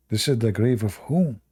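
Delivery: usual speaking voice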